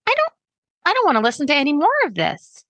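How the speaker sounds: high, fast, put off; put-off listener. Pretend. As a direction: recoil in disbelief. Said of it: "people are gonna say".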